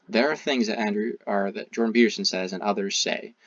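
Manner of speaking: normal talking voice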